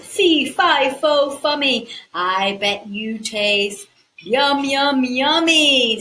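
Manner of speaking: loud, slow, gloating giant chant. Acted. Giant gloating over Jack in his hand, slow and loud. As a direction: savour each word like a hungry giant.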